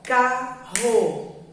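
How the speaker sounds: "carro" and "martelo" said very slowly, syllable by syllable; slow syllabified demo for children; split into syllables. Shown as a demonstration. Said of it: "isso é separar a palavra em sílaba".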